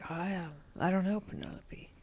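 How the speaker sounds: put-on a hushed, understated Schwarzenegger-style Austrian accent; Arnold impression. Arnold teasing a castmate about her new car, in a hushed, lightly done Austrian accent. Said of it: "I'm not doing exact right".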